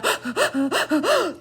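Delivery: put-on a sharp, high gasping sob like a scared baby; imitated baby gasp. Mother retelling her grandson's first cry after choking, a high imitated gasp. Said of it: "he's kinda screaming and doing a".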